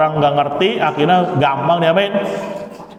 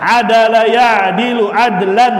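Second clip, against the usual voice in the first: recitation drill; 7 semitones higher than usual. Shown as a demonstration. Teacher reciting the masdar gallery aloud, chanting. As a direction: recite each pattern clearly for the class.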